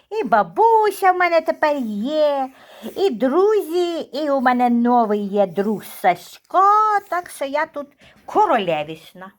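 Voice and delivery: lightly voiced proud little dog; voicing the dog. Owner speaking for her dog, slow and pleased with itself.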